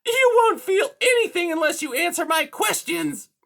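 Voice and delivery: high, loud, snarling villain; angry high-pitched cat. A narrator's reading. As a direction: snarl a threat in a piercing high voice. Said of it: "Silverside snarled".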